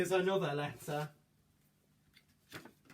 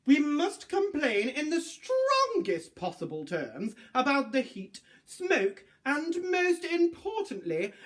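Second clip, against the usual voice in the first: stiff, indignant formal voice, rising higher; pompous lawyer; 7 semitones higher, 4 dB louder than usual. Acted. Lawyers complaining to Alex about the dragon, pompous and indignant. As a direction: read like a huffy solicitor complaining.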